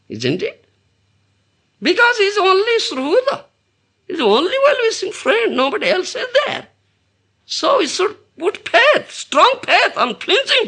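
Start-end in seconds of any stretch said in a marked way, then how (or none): 1.8-10.7 fervent preaching. Acted. Preaching faith at full force.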